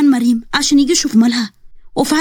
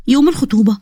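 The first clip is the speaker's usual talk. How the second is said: plain reading voice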